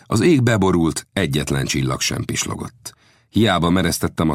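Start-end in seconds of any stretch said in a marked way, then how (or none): none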